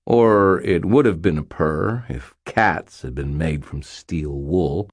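plain reading voice

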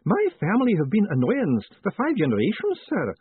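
high-voiced, fussy old-retainer clerk; Miss McAlariat, doubtful. Clerk doubting the gods will send Moist money, high voice. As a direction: object respectfully in a high character voice.

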